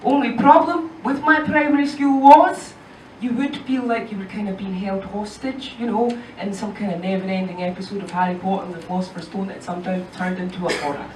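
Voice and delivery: ordinary delivery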